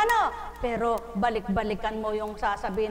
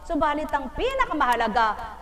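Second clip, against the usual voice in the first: usual speaking voice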